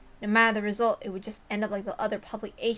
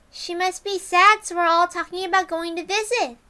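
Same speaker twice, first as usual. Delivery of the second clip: sweet Rika; 9 semitones higher, 7 dB louder than usual. Acted. Rika telling Keiichi of a visit plan, sweet and high. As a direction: say it sweetly as if happy news.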